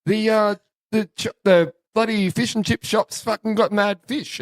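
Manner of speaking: halting, high-pitched Japanese tourist putting on Aussie; Japanese-Aussie accent. Retold or imitated. Tourists asking about mad fish, imitated Japanese-Aussie accent. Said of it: "trying to be Aussie as they could be".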